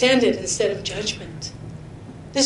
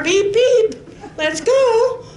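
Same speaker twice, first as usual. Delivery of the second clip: hammed-up beep beep from the driver; impatient husband; 11 semitones higher, much slower than usual.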